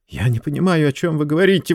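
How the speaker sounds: high, agitated exclamation; high, urgent protest; exclaiming. Acted. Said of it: "воскликнул Варбек".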